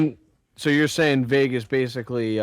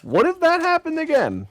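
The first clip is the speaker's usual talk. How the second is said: high wondering voice. Retold or imitated. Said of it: "I was like".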